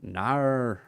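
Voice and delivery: mock Australian "no" with an r-coloured vowel; Australian accent. Streamer showing how Australians say no, mock accent. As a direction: draw out "no" like an Australian. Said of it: "the way that Australians say no".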